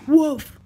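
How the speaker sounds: one loud pretend dog woof; puppy bark. Puppy barking in toy play.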